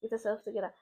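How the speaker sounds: quiet, low-key mantra to oneself; urgent self-talk. Retold or imitated. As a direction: repeat it softly under your breath, like steadying yourself. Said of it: "to myself".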